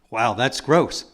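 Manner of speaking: ordinary talk, nothing marked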